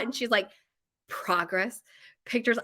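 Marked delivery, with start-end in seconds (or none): 1.1-2.6 imitated big reveal, fighting a laugh; holding back laughter. Retelling sister's best idea, holding back laughter. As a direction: say it in pieces, trying not to laugh. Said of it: "I can't even say that without laughing".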